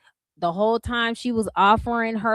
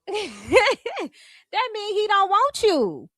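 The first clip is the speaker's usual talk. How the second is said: laughing; 8 semitones higher, much slower than usual. Real, not acted. Laughing mock reaction.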